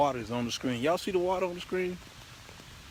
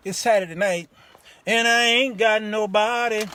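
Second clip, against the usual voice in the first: louder and higher hammed-up wail of loneliness; mock lament; 7 semitones higher, 9 dB louder than usual. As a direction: ham up being all alone.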